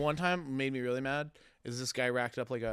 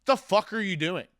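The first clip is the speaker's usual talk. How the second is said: loud, high-pitched outburst; retold angry bartender snapping at a customer; angry confrontation; 7 semitones higher, 8 dB louder than usual. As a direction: call out a customer, loud and incredulous.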